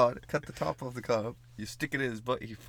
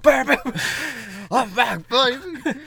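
loud, much higher triumphant yell; revived and shouting; 13 dB louder, slower than usual. Pretend. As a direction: burst back to life with a shout. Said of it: "and they're like".

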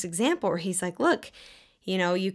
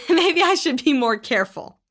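laughing; 6 semitones higher, 6 dB louder than usual. Real, not acted. Laughing at own example.

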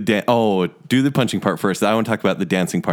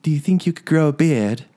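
higher, coy Belle voice; 6 semitones higher than usual. Retold or imitated. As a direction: ask it coyly as Belle. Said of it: "She goes".

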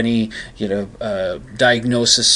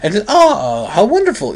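high, slow, swooping posh English wonder; theatrical mock upper-class English rapture; 8 semitones higher, 4 dB louder, slower than usual. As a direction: marvel in a hammed-up upper-crust English accent. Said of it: "He starts doing that".

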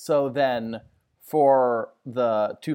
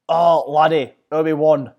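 mock Irish accent; 3 semitones higher, 7 dB louder than usual. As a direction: ham up a stage Irish accent as Qui-Gon. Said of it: "I'm speaking with an Irish accent".

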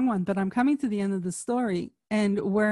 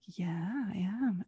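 soft doctor reply; 2 semitones lower, 9 dB quieter, slower than usual. Retold or imitated. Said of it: "he was like".

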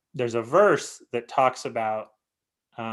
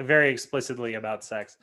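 normal talking voice

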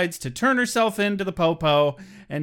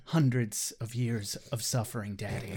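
brooding murmur; 7 semitones lower, 8 dB quieter than usual. Pretend.

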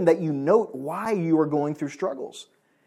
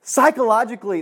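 laughing; 6 semitones higher, 4 dB louder than usual. Real, not acted. Laughs after stumbling on word.

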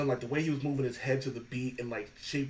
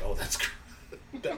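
laughing sarcasm; 10 semitones higher, 4 dB quieter, much slower than usual. Retold or imitated. Deadpan reply to host's offer.

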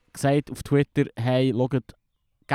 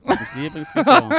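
much higher, louder excited squeal; 12 semitones higher, 6 dB louder than usual. Acted. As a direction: gush about it like a fangirl. Squeals same line much higher.

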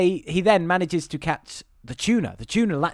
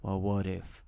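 slow, low, hushed movie-star drawl; McConaughey-style low murmur; low conspiratorial drawl; 10 semitones lower, 7 dB quieter than usual. Retold or imitated. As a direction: lean in and drop the voice, slow. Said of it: "at some point he goes".

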